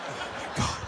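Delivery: hushed, voiceless gasp; stunned onlookers. Retold or imitated. Passengers staring at the man, stunned and whispering. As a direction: whisper it under your breath. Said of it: "They were just looking like".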